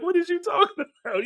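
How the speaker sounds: high, laughing disbelief; answering the friend's news, high and laughing; surprised disbelief. Retold or imitated. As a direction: ask it in stunned laughter. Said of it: "I'm like".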